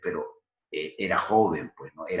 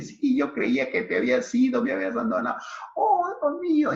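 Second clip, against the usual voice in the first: put-on much higher, loud, swooning girl voice; overjoyed young girl; 4 dB louder, faster than usual. Francisca sees Carlos came back, high swooning delight. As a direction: squeal it like a girl swept off her feet.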